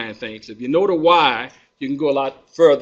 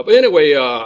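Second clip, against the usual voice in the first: ordinary talk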